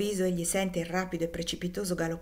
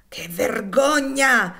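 loud, slow outraged cry; a boy crying out in shame and anger; shouting; 3 semitones higher, 9 dB louder, much slower than usual. A narrator's reading. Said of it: "gridò egli sollevando le mani". (grido lento e indignato, molto forte)

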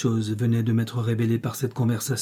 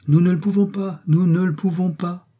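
firm, clipped refusal, pitched higher and louder; curt and resolute; 6 semitones higher, 3 dB louder than usual. A narrator's reading. Saint John cutting off Jane's plea, curt and resolute. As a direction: shut the idea down flatly, no room to argue. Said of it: "d'un ton bref et résolu".

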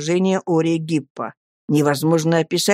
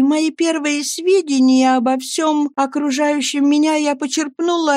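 put-on a higher, earnest young wife's voice; Annie pleading; 7 semitones higher than usual. Young wife begging her friends to speak, pleading.

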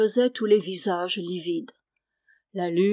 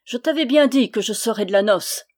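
gloating; 3 semitones higher, 7 dB louder than usual. A narrator's reading. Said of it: "Sa mâchoire souriait diaboliquement".

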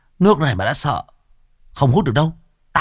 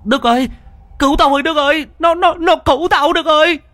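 very high, loud terrified plea; panicked cry for help; 5 dB louder than usual. Acted. Drowning boy begs Đức for help, terrified high cry. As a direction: scream for help as something grabs you. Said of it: "giãy giụa hốt hoảng".

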